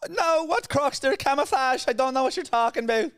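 shrieky, loud mock-Irish disguise; high-pitched Irishman. Exposed robber bluffing a friend, high-pitched Irish accent. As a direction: deny it in a squeaky Irish voice. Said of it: "a high-pitched Irishman".

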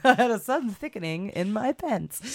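giggling through the words; cracking up mid-sentence; laughing. Real, not acted. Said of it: "laughing at own slip".

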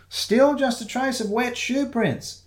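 high, slow sleuth's voice; Shiloh under table. Acted. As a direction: draw it out high, studying the clues. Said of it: "he said from under the table".

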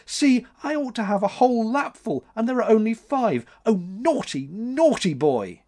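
high-pitched tearful reproach; weeping. A narrator's reading. June scolds Max over her roses, weeping. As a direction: wail high, slowing on the final scolding. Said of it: "wept June, a fair young woman".

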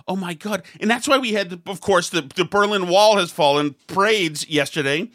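ordinary delivery

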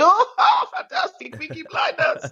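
high-pitched, laughing mock excitement; delighted tourists. Boat-trip strangers reacting to Birmingham, in high excited delight. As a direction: imitate strangers thrilled to recognise the place. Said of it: "They went".

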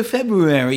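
February with a laboured rolling Feb-roo-rary; weirdo over-pronunciation. Shown as a demonstration. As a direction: overdo every r in February. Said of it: "you sound like a bit of a weirdo".

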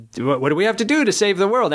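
high, quick eager questioner voice; people asking. Retold or imitated. Fans demanding answers from the author, high eager imitation. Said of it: "people were basically saying".

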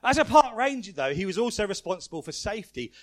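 ordinary talk

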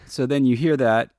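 normal talking voice

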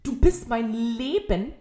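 drawn-out, exasperated imitation of a romantic declaration; incredulous mocking quote. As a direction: throw the love line back in disbelief. Mocking the line in disbelief.

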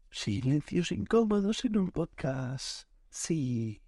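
very slow mock announcement; slow, deliberate narration of the awkward pause; drawn-out filler. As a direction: stretch out each word to fill dead air. Fills dead air while searching.

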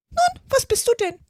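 very high, loud bird challenge; demanding pigeon. A narrator's reading. Pigeon challenging Alice to name herself, demanding. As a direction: press for an answer in a screechy bird voice.

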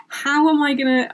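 ordinary delivery

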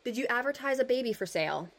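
put-on measured reading of an old article; steady reading-aloud voice; story narration. A narrator's reading. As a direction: read it like a period storyteller.

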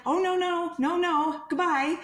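high-pitched mock refusal; alarmed brush-off. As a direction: play a seller hurrying off the phone. Acts out seller refusing the caller.

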